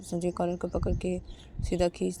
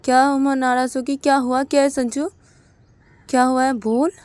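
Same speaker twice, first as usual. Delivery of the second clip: worried questioning; 5 semitones higher, 10 dB louder than usual. Retold or imitated. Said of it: "Sanju की mummy बोलती कि".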